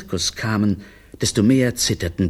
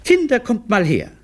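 high mother's summons; raised, high-pitched mum; calling out; 14 semitones higher, 4 dB louder than usual. Acted. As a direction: call the children to come here. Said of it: "rief Olivia".

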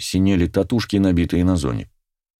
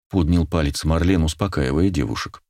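plain reading voice

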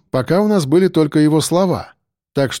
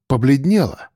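ordinary delivery

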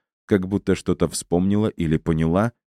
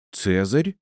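higher, startled exclamation; surprised rising question; exclaimed; 4 semitones higher than usual. Acted. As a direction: cry out the name in surprise. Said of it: "воскликнула кошкина мама".

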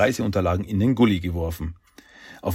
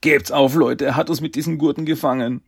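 higher, dismayed wail to the others; despairing; 6 semitones higher, 4 dB louder than usual. Acted. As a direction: give up in despair. Mike gibt in der Falle auf. (verzweifelt, mit hoher Stimme)

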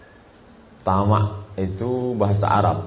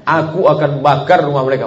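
loud, higher angry threat "Aku akan bakar rumah mereka"; 6 semitones higher, 7 dB louder than usual. Shown as a demonstration. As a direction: spit out "bakar" as a real threat. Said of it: "ini ungkapan marah".

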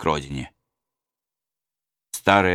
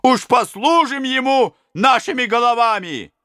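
shouting robbers; 4 dB louder than usual. Acted. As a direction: cheer it back at him. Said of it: "закричали разбойники".

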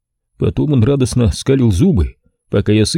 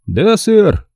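brisk butler; 11 semitones higher than usual. A narrator's reading. Butler answering his boss, brisk and higher. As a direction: snap to it like a servant.

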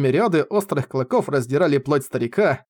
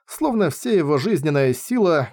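plain reading voice

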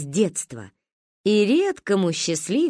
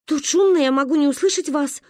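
higher, louder insistent excuse; stubborn protest; 7 semitones higher, 3 dB louder than usual. Acted. Mother resists the swimming lesson, high and insistent. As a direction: dig in, anxious. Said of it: "упиралась мама".

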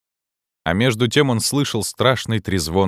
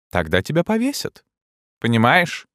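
lightly voiced menacing king; a hint of grim threat; 7 semitones higher than usual. A narrator's reading. Clopin threatens.

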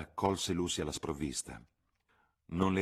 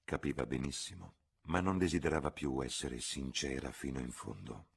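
usual speaking voice

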